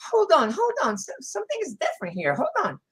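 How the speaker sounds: high, halting mock-wife voice; suspicious wife voice. Wife senses something different during lovemaking, suspicious and high. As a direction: break off in high-pitched doubt.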